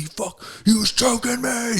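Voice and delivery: mock outrage at being choked; pissed-off yell. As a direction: opponent yelling at the ref after tapping out, imitated rage. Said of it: "all pissed off".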